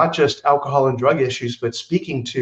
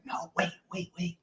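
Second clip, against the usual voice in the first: much softer, urgent half-whisper; whispered plea to hold on; hushed; 4 semitones higher, 16 dB quieter than usual. Retold or imitated. His buddy whispering across the seats to wait.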